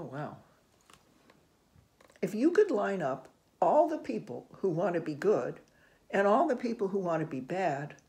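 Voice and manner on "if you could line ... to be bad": louder, rising, eager read-aloud; building excitement. A narrator's reading. Reader reaching the hopeful climax for kids, louder and brighter. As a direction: build it up with wonder.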